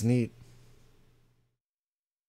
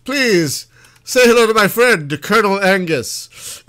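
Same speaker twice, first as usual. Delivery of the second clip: mock grand introduction; 6 semitones higher, 9 dB louder than usual.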